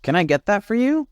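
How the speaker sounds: high, quick friendly offer; helpful chameleon. Acted. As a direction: pop up eagerly to help.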